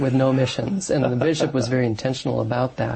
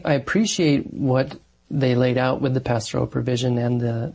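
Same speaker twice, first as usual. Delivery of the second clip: ordinary talk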